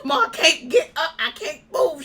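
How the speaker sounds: half-laughing mock desperation to make her believe; urgent plea to mom. Daughter on the phone telling mom she's stuck, urgent retold plea. Pleading with disbelieving mother on phone.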